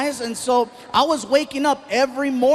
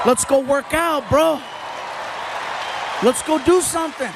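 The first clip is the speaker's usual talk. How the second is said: gym-bro hype; 3 semitones higher than usual. Retold or imitated. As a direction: hype him up like a workout partner. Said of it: "always encouraging me to go to the gym".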